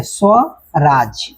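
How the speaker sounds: Swaraj very slowly, split as स्व and राज; स्व, pause, then राज in Swaraj; syllable by syllable. Shown as a demonstration. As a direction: stretch Swaraj into two separate beats. Teacher breaks dictated word into parts.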